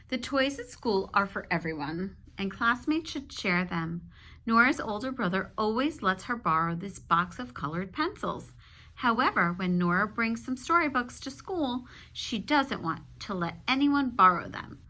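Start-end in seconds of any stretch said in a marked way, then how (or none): none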